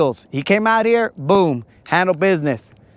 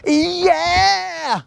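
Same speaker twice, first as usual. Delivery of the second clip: high-pitched excited cry; 9 semitones higher, much slower than usual. Retold or imitated. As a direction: squeal it out, eager to go. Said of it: "I was all like".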